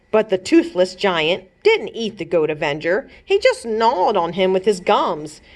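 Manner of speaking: usual speaking voice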